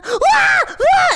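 very high, loud imitated shriek; two sudden mock screams; jump scare shriek. Streamer imitating a jump scare on stream, high shriek.

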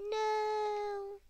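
high, eerie drawn-out no; a gamer teasing in a creepy voice. Pretend. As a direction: make it spooky and strange. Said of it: "that sounded so creepy".

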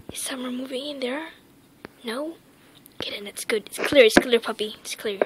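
soft unvoiced whisper; hushed whispered checking. Real, not acted. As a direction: whisper to the puppy, sneaking. Checking room, sneaking.

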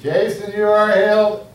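high, loud cry of "you are healed"; sudden high, ecstatic proclamation; excited declaration. Real, not acted. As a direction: burst out with joy as you declare it. Excited healing shout to Jason.

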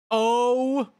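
surprised. Real, not acted. Startled by alien.

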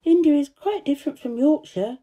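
lightly voiced girl pondering the matter; thoughtful, measured. A narrator's reading. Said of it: "Mary said slowly".

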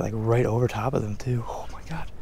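hushed excited whisper, breath only; amazed whisper at the water's edge. Real, not acted. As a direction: whisper so the fish don't spook. Lure right over the fish.

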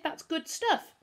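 high-pitched indignant objection; protesting. A narrator's reading. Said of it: "Humphrey protested".

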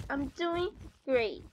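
ordinary delivery

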